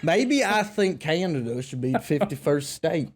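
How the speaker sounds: put-on Louisiana-Texan twang; hammed-up Southern accent. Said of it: "why I'm talking like this".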